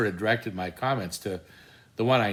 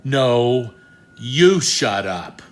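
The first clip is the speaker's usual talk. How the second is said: louder, slow retort; loud comeback; 2 semitones higher, 8 dB louder, much slower than usual. Retold or imitated. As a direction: fire the words back louder and slower. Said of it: "responded wittily".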